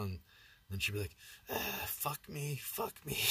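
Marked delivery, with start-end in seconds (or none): 1.5-3.2 slow, high, drawn-out moan of the words. Retold or imitated. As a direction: stretch each fuck me out like a strange moan. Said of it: "turned into a fucking Doctor Jekyll and Mister Hyde".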